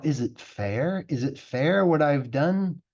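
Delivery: plain reading voice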